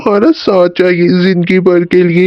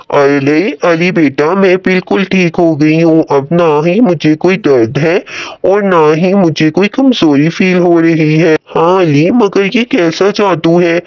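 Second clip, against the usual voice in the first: lower-pitched mother voice, telling her son of her cure; lightly voiced mother, relieved then puzzled; amazed mother; 3 semitones lower than usual. Voices Ali's mother in story.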